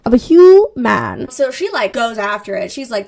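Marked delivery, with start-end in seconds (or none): 0.0-1.3 "human" stretched out and very loud; loud, hammed-up reveal on "human"; dramatic emphasis. Shown as a demonstration. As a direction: boom "human" slowly, syllable by syllable. Third, biggest dramatic repeat.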